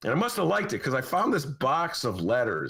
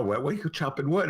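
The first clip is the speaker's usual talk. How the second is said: normal talking voice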